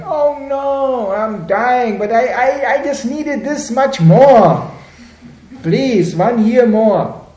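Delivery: high, loud, drawn-out wail; hammed-up dying plea. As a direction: beg desperately in mock agony. Acted dying person begging for time.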